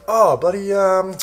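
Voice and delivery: high, loud, delighted greeting of an acquaintance; cheery greeting. Retold or imitated. To a mate at a party, a high, loud, happy hail. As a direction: call it out high and loud like spotting a friend. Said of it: "You can be like".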